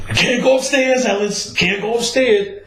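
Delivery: put-on squeaky, urgent girlfriend warning him off; high girlfriend voice. Girlfriend begging Ellis not to go upstairs, high and pleading. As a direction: pitch way up, pleading like his girlfriend.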